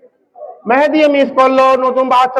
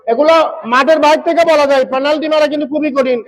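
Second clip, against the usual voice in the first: ordinary delivery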